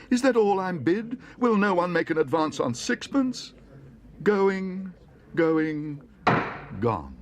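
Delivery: high, loud pleading calls; desperate auctioneer. Acted. Auctioneer pleads then gives up on the sale.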